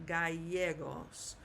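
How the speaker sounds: "gallegos" as ga-YE-gos, slowly joined up; careful Spanish "gallegos", stressed YE; correct Spanish Gallegos. Shown as a demonstration. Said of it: "double L is gonna always sound like a Y".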